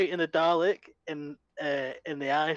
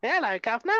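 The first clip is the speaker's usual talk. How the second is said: hammed-up Oliver Twist cockney, pitched up; London accent; 7 semitones higher, faster than usual. Teased about sounding like Oliver Twist, exaggerated London accent. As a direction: play up a cheeky London street accent. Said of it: "sound like you're from Oliver Twist".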